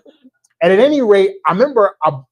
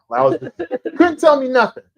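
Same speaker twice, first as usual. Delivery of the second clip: cocky brag; 13 semitones higher, 5 dB louder than usual. Retold or imitated. Bragging about finishing draft.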